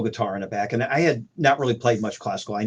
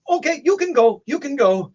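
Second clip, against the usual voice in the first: put-on Mexican accent; 3 dB louder than usual. Gunmen let him go.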